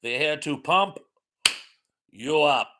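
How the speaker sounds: hammed-up mock German accent; fake German accent. Shown as a demonstration. Redoing the catchphrase for the chat, fake German accent. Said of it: "do that in my fake German accent".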